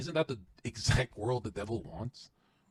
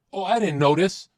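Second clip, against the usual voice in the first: sarcastic; 8 semitones higher, 10 dB louder than usual. Retold or imitated. Sarcastic feigned innocence.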